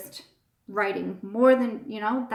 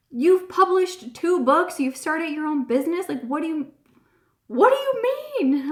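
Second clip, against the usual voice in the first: exasperated husband; 7 semitones higher, 5 dB louder than usual. Retold or imitated.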